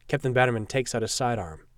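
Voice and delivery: usual speaking voice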